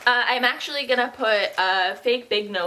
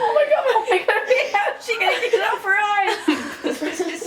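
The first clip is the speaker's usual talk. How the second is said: giggling through the words; laughing; 10 semitones higher, faster than usual. Real, not acted. Laughing at makeup mishap.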